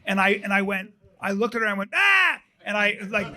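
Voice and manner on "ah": sudden high "Ah!" to startle someone; scare yell. Retold or imitated. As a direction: imitate jumping out with a yelp. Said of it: "pretending I was gonna scare her".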